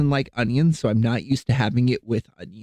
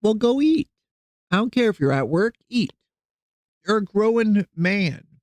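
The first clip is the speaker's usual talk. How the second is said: nagging mother voice; 7 semitones higher than usual. Retold or imitated.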